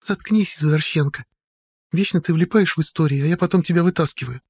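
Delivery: high, scolding woman's voice; annoyed woman. A narrator's reading. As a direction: snap at her friend, irritated. The judge Sodia voiced.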